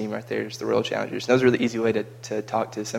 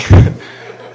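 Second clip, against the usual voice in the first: burst of laughter; 19 dB louder than usual. Real, not acted. Laughs at own impression.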